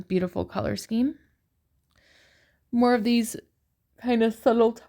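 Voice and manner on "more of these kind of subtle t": talking through a yawn; words stretched by a yawn. Real, not acted. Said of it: "Sorry, I'm yawning".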